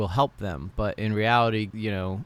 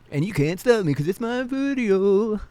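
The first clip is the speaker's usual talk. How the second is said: put-on high, drawn-out childish gloating; slow, high na-na-na style teasing; playful taunt; 11 semitones higher, 4 dB louder than usual. Childish gloating over own video.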